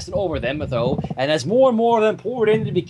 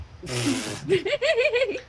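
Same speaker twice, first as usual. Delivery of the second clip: helpless laugh; 13 semitones higher, 4 dB quieter than usual. Real, not acted. Narrator cracks up.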